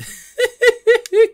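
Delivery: a loud, shrieking burst of laughter; squealing real laugh; high laugh. Real, not acted. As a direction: crack up completely. Laughing at NBC's mix-up.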